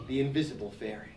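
soft and low, lightly mysterious; hushed mysterious. Acted. Anansi tells the animals, hushed and mysterious. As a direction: breathe it like a secret. Repeat of fairy listing.

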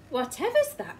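startled by the shape on the beach; wife spotting something through the telescope; exclaiming. Acted. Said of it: "she exclaimed".

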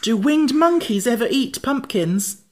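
high, loud, anxious question; shiver of fear. A narrator's reading. Jack fearing winged monkeys, high and frightened. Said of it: "with a shiver of fear".

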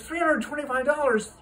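high, loud incredulous echo; a scandalized father repeating the price; shocked disbelief. Acted.

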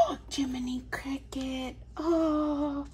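breathless gasp then a whispered name. Real, not acted. Fan opening a collectible toy, gasping whisper. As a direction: whisper it in awe after a gasp. Unboxing a favourite figure.

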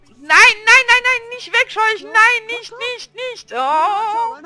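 very loud, high volley of protest; alarmed pleading. Real, not acted. Player begging game not to scare them off, alarmed shouting. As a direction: cry out to stop a mishap.

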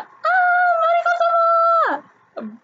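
high, delighted squeal of her name; fangirl squeal. Real, not acted. Fan recalls seeing her idol cast, squealing with delight. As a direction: squeal like you just spotted your idol.

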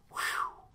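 soft unvoiced exhale; blown-out whew. Churchgoer feeling hot inside, breathy overwhelmed whew. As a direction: blow out a long breath of amazement. Acts out his reaction to heat.

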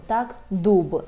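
ordinary talk, nothing marked

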